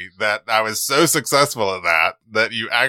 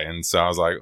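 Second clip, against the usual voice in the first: ordinary delivery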